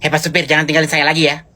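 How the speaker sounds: ordinary delivery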